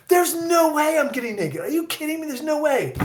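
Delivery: high-pitched incredulous protest; mock-indignant squeal of refusal; indignant co-star. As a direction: imitate an actress flatly refusing in a high voice. Voicing co-star's indignant refusal.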